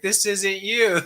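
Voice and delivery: a professor catching the cheater, half laughing; amused professor. Retold or imitated. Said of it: "He's like".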